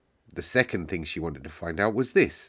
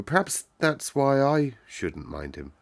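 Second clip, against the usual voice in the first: slow, higher, musing; thinking it over; 6 semitones higher than usual. A narrator's reading. Sick boy explaining to the girl, slowly musing. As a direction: work it out aloud, slowly. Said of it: "still thinking over".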